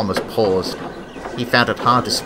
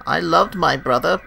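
broken voice; 6 semitones higher than usual. A narrator's reading. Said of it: "with a broken voice".

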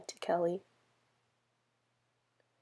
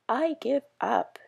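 higher, louder, slow cry of giving up; exasperated yell; 5 semitones higher, 4 dB louder than usual. Acted. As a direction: throw up your hands and yell it out slowly. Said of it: "yelled Timmy".

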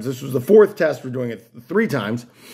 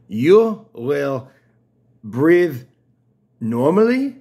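mock Latin American accent; slower than usual. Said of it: "this sounds Latin American and that's not right either".

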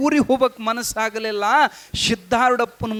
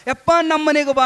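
higher, louder pleading call; eager invitation; 6 semitones higher, 3 dB louder than usual. Acted. Devotees begging the monk to visit, eager call. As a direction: urge him home, more eagerly.

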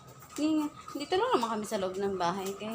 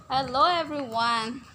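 dog's voice; slower than usual. Pretend. Voicing the dog introducing itself.

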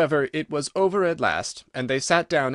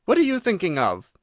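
raised-pitch severe reproach; 6 semitones higher, 4 dB louder than usual. A narrator's reading. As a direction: demand attention sternly. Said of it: "said the Mouse to Alice severely".

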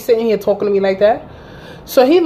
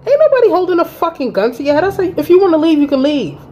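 higher, half-shouted comeback; retold fed-up yell; 8 semitones higher than usual. To her boyfriend threatening to leave, a high retold yell. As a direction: throw his threat back at him. Retelling her blowup at him.

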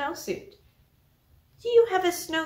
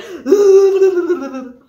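gasp; 6 semitones higher, 14 dB louder than usual. Acted. Storyteller gasp, laughing.

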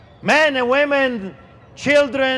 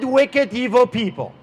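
ordinary delivery

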